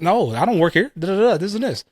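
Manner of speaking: imitating wife's high, snappy brush-off; mock his wife snapping at a stranger; snippy retort. Wife brushing off a shopper, high and snippy.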